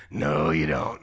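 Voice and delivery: very deep, booming divine voice; Holy Spirit. Retold or imitated. Spirit insists the pastor not sit down, very deep voice. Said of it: "the Holy Spirit goes".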